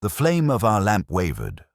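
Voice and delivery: usual speaking voice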